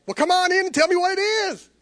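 high, loud cheerful cousin; bright, welcoming hostess; Donna's welcome. Retold or imitated. Cousin Donna inviting the nervous preacher in, cheerful.

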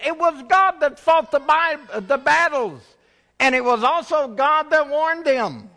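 high, loud preaching warning; fiery preaching. Acted. Pastor warning the church of a king, fiery preaching. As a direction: thunder out God's warning.

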